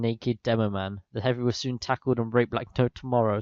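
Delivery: ordinary talk, nothing marked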